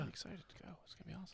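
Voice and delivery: whispering. Acted. Deadpan joke.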